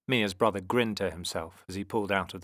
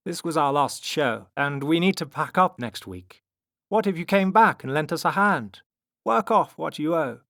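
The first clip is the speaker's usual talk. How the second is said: raised, lightly voiced offer; strong man bargaining; 7 semitones higher, 6 dB louder than usual. A narrator's reading. Strong man offers the children work, higher and louder. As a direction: propose a deal in raised pitch.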